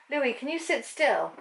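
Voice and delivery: mild pet scolding; talking to the dog. Real, not acted. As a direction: call the pet to settle down.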